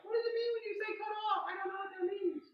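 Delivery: very high, quick mock-child voice; whiny confused kid. Clinician imitating kids puzzled by cut off, high whiny child voice. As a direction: squeak it out like a baffled kid. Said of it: "a lot of kids be like".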